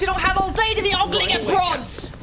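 high, loud, barking scold; captain bellowing orders at the gawking sailors; angry shout. Acted. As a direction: bark it at the lazy crew. Captain yells at idle crew.

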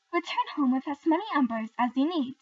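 loud commanding wizard; strange wizard. Acted. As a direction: boom it out like an old wizard. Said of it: "said the strange wizard".

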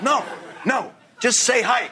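high, insistent correction; exasperated director. Retold or imitated. Director tells extra to drop the accent, exasperated.

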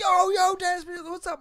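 high-pitched hail; high, eager imitation; mock excited greeting. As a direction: greet a recognized guest at the bar.

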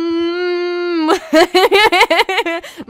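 high, loud burst of laughter; loud laughing. Real, not acted. Player reacting to the game chat, loud laughing. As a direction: crack up loudly.

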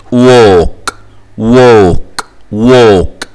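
ووك with a long O, no L; silent L. Shown as a demonstration. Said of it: "L سايلنت و O". (ووك بمد الـ O والـ L صامتة)

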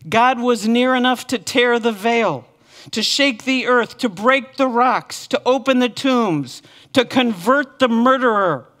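loud, rising preaching cry; building proclamation. Real, not acted. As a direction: hammer each phrase home.

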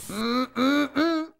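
ordinary talk

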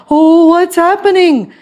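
a bystander frightened by the waking dragon; startled onlooker. Acted. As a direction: gasp it out in shock.

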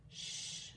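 long, hushed "shh"; gentle drawn-out shushing; soft shush. Acted. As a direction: hush the listeners softly. Reader hushes after the signs.

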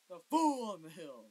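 loud, at normal pace; just call the title out loud; shouting title. Real, not acted. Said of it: "And I'm just shouting".

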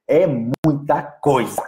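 "É muita coisa" slowly, stretched word by word; drawn-out emphasis. Real, not acted. Teacher stressing how much work it is, drawn-out emphasis. Emphatic repeat of his point.

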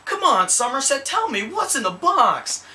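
high, drawn-out, overwrought movie-star pleading; terrible Brad Pitt impression. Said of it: "that was a terrible Brad Pitt impression".

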